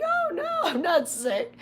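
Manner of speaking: high and tearful, voice breaking upward; insisting not sick. Retold or imitated. Answering people who ask if she's sick, high and near tears. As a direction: squeak out a pleading denial. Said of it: "I'm like".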